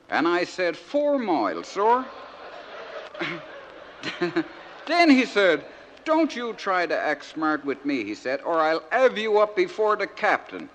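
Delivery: naive outport soldier son reading his letter; broad dialect, lively comic recitation; George from Crinkle Cove. Acted. Soldier son writing home to Mama and Dadda, naive and earnest.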